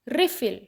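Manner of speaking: Refill with stress on RE; refill: REE-fil, noun stress on the front; stress on first syllable. Shown as a demonstration. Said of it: "री के ऊपर stress दें".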